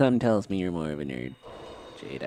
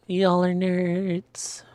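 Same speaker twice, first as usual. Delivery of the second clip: slow, high teasing; teasing drawl; 8 semitones higher, much slower than usual. Real, not acted. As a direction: tease them slowly. Teasing chat.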